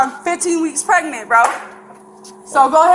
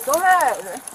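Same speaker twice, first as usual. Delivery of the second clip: normal talking voice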